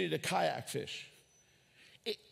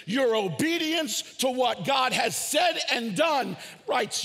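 slow, loud, higher preaching build; preaching shout; 5 semitones higher, 4 dB louder, slower than usual. Real, not acted. Pastor thundering to the congregation, slow loud shout. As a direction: bring it home at full force.

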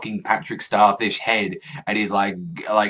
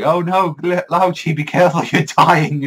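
raised, louder voice of worried warning; mock alarm; 7 semitones higher, 7 dB louder than usual. Reviewer voicing panic for the dying Lao Ji, mock alarm.

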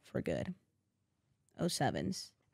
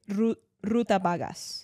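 mock Spanish accent. To a friend, stumbling on an unknown word, "Ruta vagas" in playful mock Spanish accent. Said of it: "I say it in a Spanish accent".

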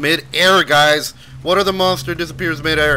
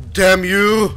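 loud, higher angry shout; angry curse; 7 semitones higher, 9 dB louder than usual. Real, not acted. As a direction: curse at the screen. Cursing at enemy.

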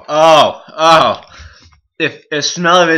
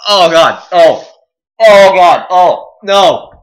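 loud, raised moans of pain; agonised moaning; 5 semitones higher, 8 dB louder, slower than usual. Real, not acted. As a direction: groan in burning misery. Suffering from the burn.

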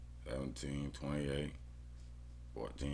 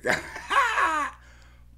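high mocking laugh; 8 dB louder than usual. Real, not acted. Bettor laughing at a team's poor record, high mocking laugh.

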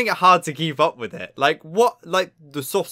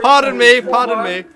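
mock polite apology; 8 semitones higher, 8 dB louder than usual. Mock apology after clip burp.

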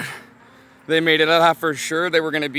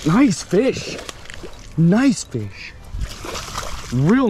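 excited; 6 semitones higher, 4 dB louder, much slower than usual. Real, not acted. Landing a big fish.

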